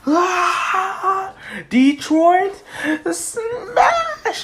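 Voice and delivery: high, drawn-out battle cry; attack yell. Acted. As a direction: yell out a heroic finishing move. Hero yells his attack move.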